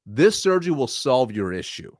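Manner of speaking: firm, certain statement; assured, decisive diagnosis; confident doctor. Shown as a demonstration. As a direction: deliver it as a sure verdict. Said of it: "one doctor is way more confident than the other".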